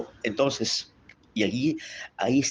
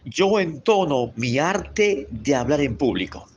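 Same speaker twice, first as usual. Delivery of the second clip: slow, clear declaration; slow affirmation; 2 semitones higher than usual. Shown as a demonstration. Teacher leading students in a slow spoken affirmation. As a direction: say it slowly for them to repeat. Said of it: "repita conmigo".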